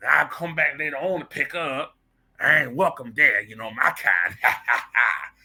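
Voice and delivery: hammed-up old servant caricature. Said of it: "She thinks this is how we sound".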